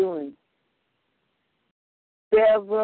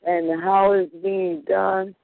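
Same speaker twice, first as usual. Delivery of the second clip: ordinary talk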